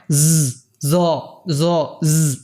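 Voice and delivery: "জ" as a buzzed z, like the s in rose; wrong Z sound. Shown as a demonstration. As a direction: buzz each "জ" as a separate z, showing the mistake. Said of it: "এরকম উচ্চারণ না". ("জ", রোজ শব্দের এসের মতো ভুল উচ্চারণে)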